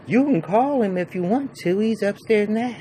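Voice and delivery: a hammed-up lady voice; high mock woman's voice. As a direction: imitate her squeaky reply. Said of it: "She said".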